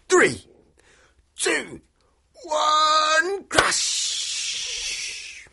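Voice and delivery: big dramatic countdown then crash; building countdown. A narrator's reading. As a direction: count down with mounting excitement.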